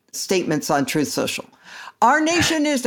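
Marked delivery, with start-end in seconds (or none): 2.0-2.8 shouting capitalized words. Shown as a demonstration. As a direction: shout it like an all-caps post. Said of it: "I should shout this because it's in all caps".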